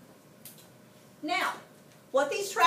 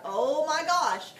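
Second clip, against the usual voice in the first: loud, drawn-out dismay; higher, louder pretend alarm; mock freak-out; 4 semitones higher, 6 dB louder, much slower than usual. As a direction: stretch it out in imagined horror. Imagined freak-out at the injury.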